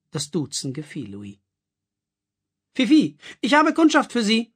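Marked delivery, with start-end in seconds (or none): 2.7-4.5 much higher, louder call across the salon. A narrator's reading. Madame Marilou calls Fifi over for a customer. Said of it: "rief Madame Marilou".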